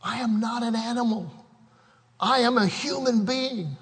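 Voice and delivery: hushed strained cry of protest; a pained plea, pulled back; retold scream. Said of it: "he screams out".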